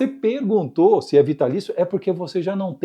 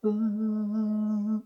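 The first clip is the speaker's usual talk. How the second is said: a soft, held sung ah on any pitch; 5 semitones higher, 7 dB quieter than usual. Shown as a demonstration. Teacher singing a random pitch for the student, a held soft ah. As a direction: hold one steady tone on ah. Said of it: "Você vai manter sua voz".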